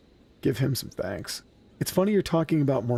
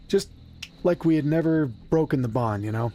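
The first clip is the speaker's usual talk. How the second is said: ordinary talk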